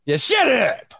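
high, strained "shut up"; mock irritated shout. Co-host fed up with the needling, mock irritated shout. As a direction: yell to cut the pest off.

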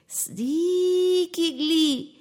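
very slow, stretched sneakingly; sneaky drawn-out. A narrator's reading. Storyteller teasing the children as Krishna sneaks in. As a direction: tiptoe through the word.

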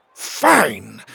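loud, spat-out royal fury; furious spitting. A narrator's reading. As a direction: spit the word out in anger. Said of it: "spat furiously as he spoke".

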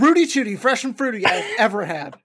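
laughing through a mock complaint; hammed-up diner griping about breakfast; a touch of customer outrage; complaining customer.

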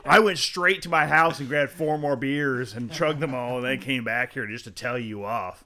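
hammed-up telling-off, starting loud and easing off; mock angry rant. Drunk heckler back to tell off the comic, mock angry rant.

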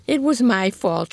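mild whimper. A narrator's reading. Mother-in-law apologizing after the dog bite, whimpering mildly. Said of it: "she whimpered".

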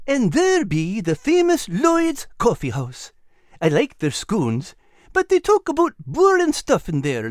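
high, eager little animal voice; Al's high voice. Acted. Al urging Nigel toward the bank, high and eager. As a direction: voice Al bright and high. Al describes coffee house.

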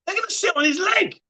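a squeal of amused disbelief; high excited yelp. Real, not acted. To the viewer about pigeons, high excited yelp. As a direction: shoot the voice way up in glee.

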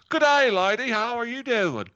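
very high, booming mock Australian greeting; forced Aussie twang, shouted high; hard, loud Australian accent. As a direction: belt out an overdone Australian accent. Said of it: "I mean, hard and loud".

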